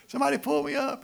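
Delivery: high, loud comic yell; mock cry for rescue. Hanging man begs anybody above, in a high shout. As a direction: call up the well in an exaggerated high voice.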